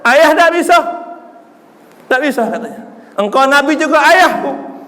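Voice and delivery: high, loud pleading preaching; tearful storytelling climax. Acted. Preacher voicing Fatimah begging her father, high and loud. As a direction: cry out Fatimah's plea.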